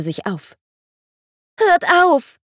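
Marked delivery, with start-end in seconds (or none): 1.6-2.4 put-on very high, louder desperate child's plea; a frightened child begging them to stop; pleading cry. A narrator's reading. The child begs the fighting men, high and pleading. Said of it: "flehte es".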